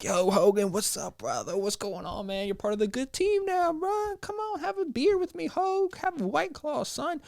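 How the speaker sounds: rising to a very high, excited bro voice; buddy greeting Hogan. Retold or imitated. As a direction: hype up your new best friend, pitch climbing way up. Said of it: "I was like".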